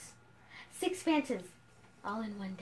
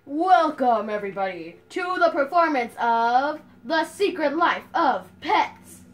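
announcer voice; 8 dB louder than usual. Pretend. Announcing the play to audience.

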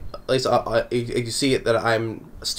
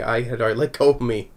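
ordinary talk, nothing marked

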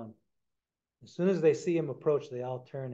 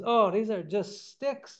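higher, slower dawning realization; 8 semitones higher, 5 dB louder, slower than usual. A narrator's reading. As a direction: let it sink in slowly. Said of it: "then he finally realizes".

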